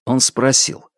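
usual speaking voice

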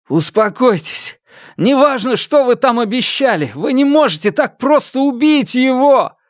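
high, forceful appeal to a crowd; urgent shout. Acted. Фан Му trying to stop a mob killing, urgent shout. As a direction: call out to stop them, pitched high.

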